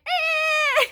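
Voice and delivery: high, slow, broken sobbing voice; imitating bawling. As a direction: blubber it out as if in tears. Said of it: "Like, I was bawling".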